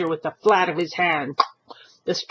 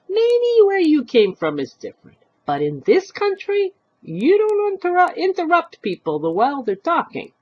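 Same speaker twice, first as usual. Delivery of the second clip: scolding; 12 semitones higher, 4 dB louder than usual. Acted. Old woman scolding stranger.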